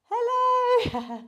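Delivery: high, drawn-out hello; light up as you greet her; delighted greeting. Real, not acted. Instructor spotting her mum on Zoom, high delighted hello.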